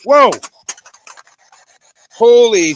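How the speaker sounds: sudden loud startled cry; startled shout. Real, not acted. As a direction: shout in real shock. Meter falls suddenly.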